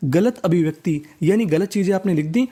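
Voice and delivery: usual speaking voice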